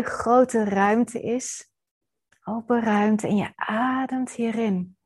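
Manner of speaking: ordinary delivery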